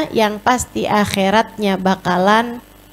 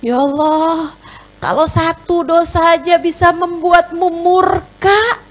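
much higher and louder, crying out in fear; weeping fearful prayer; 12 semitones higher, 6 dB louder than usual. Acted. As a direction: wail to God, terrified of your sins. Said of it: "menangis karena takut". (doa menangis ketakutan, berteriak tinggi)

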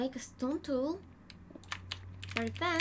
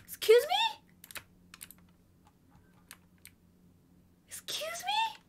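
indignant. Real, not acted. Indignant at game.

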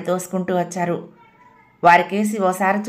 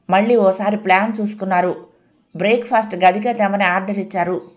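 usual speaking voice

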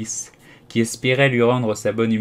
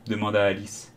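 ordinary delivery

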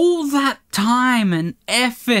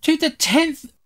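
angry rant; 9 semitones higher than usual. Real, not acted. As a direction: spit it out in fury. Gamer ranting after dying.

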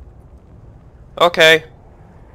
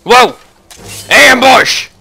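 high, loud shout of alarm; startled battle yell; 11 semitones higher, 6 dB louder, slower than usual. Real, not acted. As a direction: warn of the ambush. Sudden attack in game.

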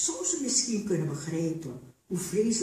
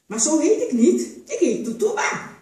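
much higher and louder, insisting; indignant protest; 10 semitones higher, 7 dB louder than usual. A narrator's reading. Tutuba protests to Sasa about her name, indignant. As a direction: claim your own name.

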